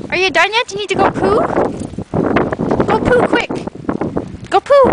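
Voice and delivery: high, rising pet talk to the dog. Real, not acted. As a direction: coax the dog, high and sing-song. Urging dog in baby talk.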